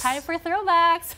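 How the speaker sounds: excited announcement. Real, not acted. Host announces photo throwbacks.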